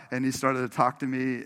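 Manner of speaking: ordinary talk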